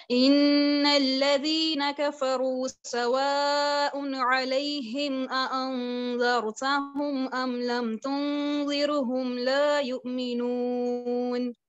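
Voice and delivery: slow, drawn-out Quranic recitation with hamzas kept. Shown as a demonstration. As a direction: recite it slowly, tajweed style. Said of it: "मैं इसको पढ़ूंगी".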